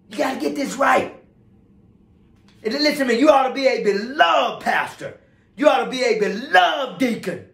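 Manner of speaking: high, forceful exhortation; fervent preaching. Real, not acted. Preacher exhorting pastors and deacons, fervent preaching. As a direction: urge it on them from the pulpit.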